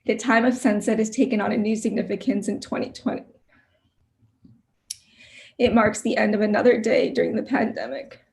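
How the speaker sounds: emotional. Real, not acted. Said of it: "I don't know why I'm getting emotional".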